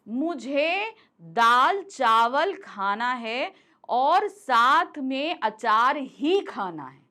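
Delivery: teacher stressing अचार ही. Shown as a demonstration. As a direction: drag the line out, punching अचार ही. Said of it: "तो हम अचार के ऊपर जोर दे रहे हैं".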